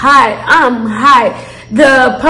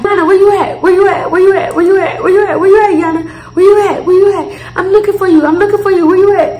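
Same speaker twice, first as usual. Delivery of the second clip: higher, calling out to find her; friend calling out; 5 semitones higher, faster than usual. Retold or imitated. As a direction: call around the lot for your friend. Friend calling out in parking lot.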